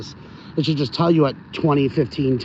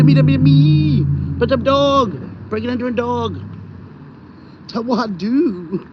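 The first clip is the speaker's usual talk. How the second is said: higher, put-on pooch voice with drawn-out words; talking for dog; 4 semitones higher than usual. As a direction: play the poodle in silly singsong catchphrases. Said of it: "So it's like I'm talking for him".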